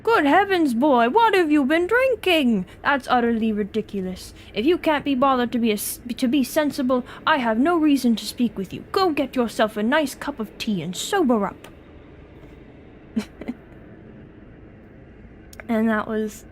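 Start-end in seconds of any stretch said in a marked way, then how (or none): 0.0-11.7 Lord Blackwood. Acted. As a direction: scold the doctor in a put-on British accent. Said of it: "Should I attempt a British accent".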